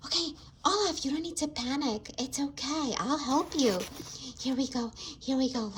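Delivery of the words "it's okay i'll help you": ordinary delivery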